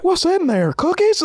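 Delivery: high, rising wonder; mock naive curiosity. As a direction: play childishly curious, pitched well up. Mocks naive peeking into danger.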